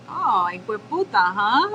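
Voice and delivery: hueputa as a knowing tease, lifted on "huh"; retold godmother catching out a swearer; godmother's reaction. Godmother catching her goddaughter swearing, retold reaction. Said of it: "She looked over at me and was like".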